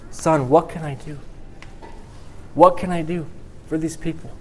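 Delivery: a touch of weeping in a father's plea; tearful father. Acted. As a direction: voice lifting and catching, close to tears. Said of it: "you got tears coming down his face".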